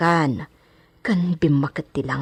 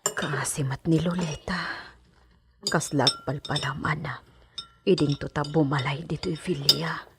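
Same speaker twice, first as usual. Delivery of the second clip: hushed whisper; 8 dB quieter than usual. Acted. Servant secretly warning about Lolita in a soft whisper. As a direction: warn her under your breath.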